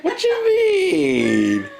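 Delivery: surprised. Real, not acted. Laughing outburst at a friend.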